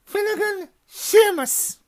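high, loud stern roll call; calling a name. A narrator's reading. McGonagall calls the next student to the stool. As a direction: call the name out across the hall.

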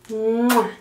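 smacked "mwah" kiss; kiss sound. Acted. Grandma kisses Dani goodnight, kiss sound. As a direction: make a big voiced kiss noise. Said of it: "le da un besito".

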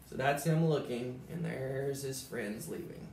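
ordinary delivery, nothing marked

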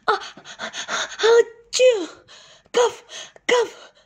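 high sneeze then coughs. A narrator's reading. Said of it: "went R B Armadillo again".

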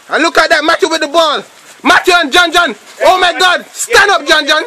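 live commentator getting worked up; excited play-by-play. Real, not acted. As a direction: call the action as it breaks.

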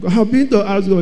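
ordinary talk, nothing marked

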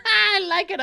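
high laugh through the words. Real, not acted. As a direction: giggle it out. Laughing at the baby voice.

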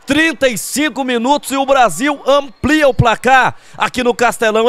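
ordinary talk

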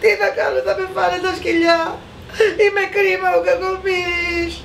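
put-on high, wailing sobs of a child; despairing crying. A narrator's reading. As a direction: wail pitifully for yourself, in tears. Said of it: "Απελπισμένος έβαλε τα κλάματα". (απελπισμένο, παιδικό κλάμα)

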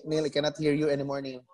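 ordinary delivery

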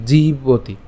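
জীবতি with a long, held ee in জী; lengthened জী in জীবতি; long vowel. Shown as a demonstration. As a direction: stretch the first syllable of জীবতি, jee-boti. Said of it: "উচ্চারণটা দীর্ঘ করছি".

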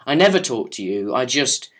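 usual speaking voice

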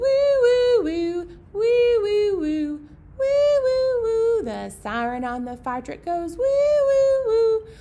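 high, loud sung siren; playful siren wails; high siren woo. Pretend. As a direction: wail like a fire truck siren. Siren sound in song.

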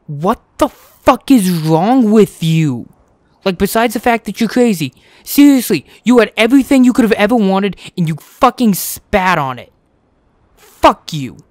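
loud, high angry rant; furious rant. Real, not acted. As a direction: rage at the betrayer. Ranting at betrayal.

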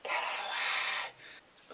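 breathy, slow panicked whisper; slow hushed mock panic; mock terrified whisper. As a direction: hiss it out as if spooked out of his mind. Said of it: "He's like".

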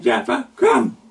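high, loud summons; calling out. Acted. Jesus calling Peter to step out of the boat, a loud high call. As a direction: call him over the water.

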